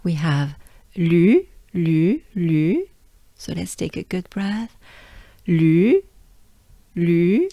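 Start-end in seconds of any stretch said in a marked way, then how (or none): 0.9-2.9 "lu" drawn out with a rising tune; French u sound. Shown as a demonstration. Teacher models the sound for learners, French u sound. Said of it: "that's the sound we're looking for". 5.5-7.5 "lu" loud, with French rounded u; French u sound. Shown as a demonstration. Take a good breath with learners, French u sound. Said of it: "that's the sound we're looking for".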